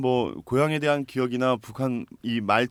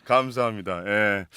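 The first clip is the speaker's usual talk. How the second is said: ordinary talk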